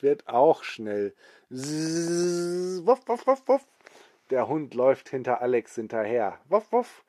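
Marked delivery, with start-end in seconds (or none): 1.5-3.7 fast barking. Acted. The sped-up dog barking as it runs, high yaps. 6.5-7.0 much higher, louder yapping barks; fast barking. Acted. The sped-up dog barking as it runs, high yaps.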